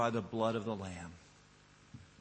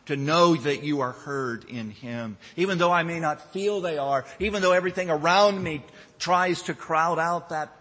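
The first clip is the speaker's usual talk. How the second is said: louder, higher, building to full force; impassioned preaching; 6 semitones higher, 9 dB louder than usual. Real, not acted. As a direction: preach it with rising fervor.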